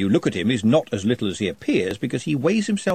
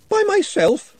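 much higher, shocked squeak; horrified; 4 dB louder, slower than usual. Acted. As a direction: recoil in dismay. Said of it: "said Ponder, horrified".

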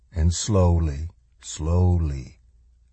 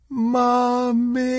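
put-on very high child voice, long drawn-out cry; child's piercing scream. A narrator's reading. Child crying out for mother in a crash, piercing scream. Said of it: "a child's piercing, heartbreaking scream".

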